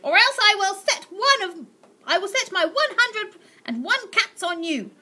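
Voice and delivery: put-on high, loud menacing old lady; threatening aunt. Aunt Greta threatening with her cats, high and loud. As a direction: threaten him shrilly.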